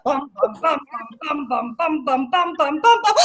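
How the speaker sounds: low thudding bum bum as he tumbles; rhythmic falling sound; falling sound effect. Acted. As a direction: drum out the fall, each bum dropping.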